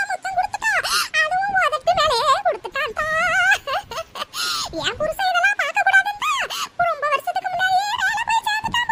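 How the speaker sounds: hammed-up sobbing lament; wailing and sobbing. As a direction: wail each phrase like a wronged old woman. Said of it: "அலறதை நிறுத்திட்டு".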